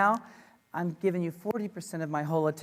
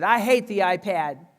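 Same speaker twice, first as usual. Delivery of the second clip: retold yell; 8 dB louder, slower than usual. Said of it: "One of the teachers yelled at me".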